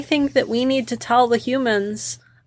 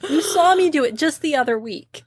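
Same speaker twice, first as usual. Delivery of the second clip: puffed up boast; 7 semitones higher than usual. Acted. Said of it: "I get all puffed up".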